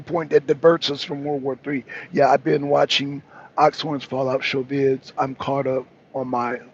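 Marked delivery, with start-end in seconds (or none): none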